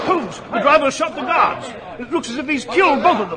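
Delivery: horror rising as you watch; alarmed. Acted. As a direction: Watson appalled at the murders. Watson sees guards shot.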